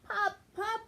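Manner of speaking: high calling voice. A narrator's reading. Penny calls for her lost Pup, high and anxious. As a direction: call into the dark space.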